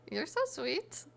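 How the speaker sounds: ordinary delivery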